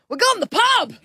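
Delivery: put-on high squeal of a kid announcing the pub; excited toddler shout. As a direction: blurt it out like a proud three-year-old. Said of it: "She goes".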